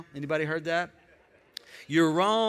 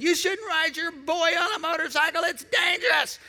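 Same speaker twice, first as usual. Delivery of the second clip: high mock yell at the dad; angry driver scolding a biker; 9 semitones higher than usual.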